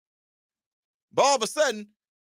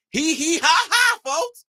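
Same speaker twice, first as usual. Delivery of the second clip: mock laugh; 5 semitones higher, 4 dB louder, slower than usual. Mock laugh after joke.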